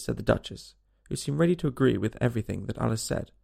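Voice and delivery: usual speaking voice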